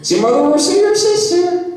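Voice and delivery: very high put-on Somerset questioning; high, mocking West Country question; mock Somerset accent. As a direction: tease with a hammed-up Somerset accent. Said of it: "always in that accent".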